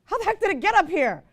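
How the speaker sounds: high startled inner voice; startled thought, voiced high; surprised. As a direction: gasp it out at the sight. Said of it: "And I think".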